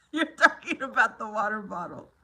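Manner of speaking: cracking up at the joke; laughing. Real, not acted. Streamer laughing at a viewer's water bottle joke.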